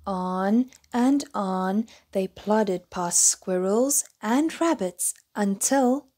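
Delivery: ordinary delivery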